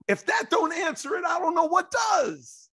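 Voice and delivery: high, loud triumphant shout, slowing at the end; emphatic shout. Real, not acted. Host clinches his debate case with a loud, high shout. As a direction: hammer home the final point.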